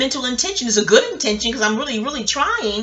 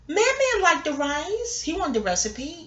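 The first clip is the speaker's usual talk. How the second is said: high-pitched, questioning protest; hurt disbelief; 6 semitones higher, 4 dB quieter, slower than usual. Real, not acted. Cook questioning Papa's verdict on her food, disbelieving. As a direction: push back in puzzled disbelief, pitch raised.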